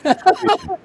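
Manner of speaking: high, loud burst of laughter. Real, not acted. Amused at the failed call.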